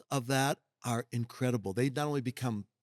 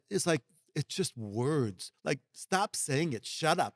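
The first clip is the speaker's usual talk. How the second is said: usual speaking voice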